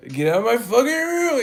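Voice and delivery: high loud whiny shout; bratty yell. Retold or imitated. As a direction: scream it at mom like a brat. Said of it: "talking like that".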